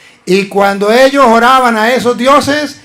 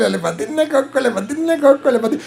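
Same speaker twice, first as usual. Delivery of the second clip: giggled softly under the breath; quiet laughing aside; 7 dB quieter than usual. Real, not acted. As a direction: laugh as you mock them. Mocking aside through laughter.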